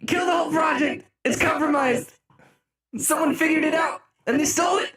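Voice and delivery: high-pitched hammed-up panic; mock outrage. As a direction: shout as if playfully scandalized. Mock outrage at stolen tune.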